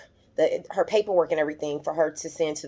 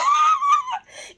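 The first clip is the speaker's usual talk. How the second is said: very high-pitched delighted shriek of laughter; high squealing laugh. Real, not acted. Viewer thrilled for Fatima, squealing with laughter. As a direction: let a squeal burst into laughing.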